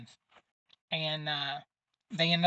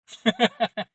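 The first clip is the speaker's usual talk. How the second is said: laugh; 5 semitones higher, 7 dB louder than usual. Real, not acted. Laughs at own story.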